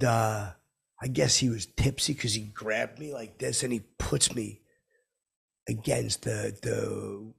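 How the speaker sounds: ordinary talk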